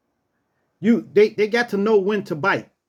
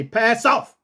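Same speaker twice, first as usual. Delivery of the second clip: raised, firm command to a guard dog; authoritative higher-pitched order; drill sergeant command; 4 semitones higher than usual. Shown as a demonstration. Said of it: "like you a drill sergeant".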